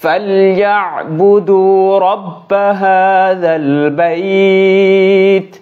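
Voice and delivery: slow, drawn-out melodic recitation; tajweed recitation, held long; Quran recitation. Acted. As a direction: chant each word long and sung. Recites Surah Quraish.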